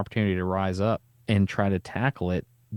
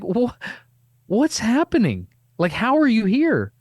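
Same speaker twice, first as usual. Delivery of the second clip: much higher and louder, slowed astonishment; stunned disbelief; 13 semitones higher, 5 dB louder than usual. Retold or imitated. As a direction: sputter in amazement, pitched way up. Stunned at Paulina being there.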